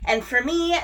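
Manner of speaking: ordinary talk, nothing marked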